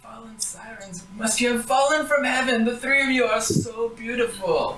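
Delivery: put-on high, flattering old woman voice; old granny. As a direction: gush over their beauty like a sweet granny.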